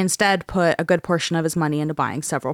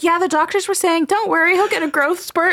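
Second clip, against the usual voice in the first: a lightly voiced mom, pitched up; imitating his mom; 10 semitones higher than usual. Imagining his mom joking to him, light mock voice. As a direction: play the mom, breezy and teasing. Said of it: "She's like".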